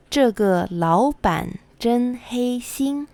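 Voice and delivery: ordinary talk, nothing marked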